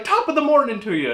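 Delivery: lightly put-on stage-Irish accent; a hint of a cartoon Irish accent; fake Irish accent. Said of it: "fake style Irish accents".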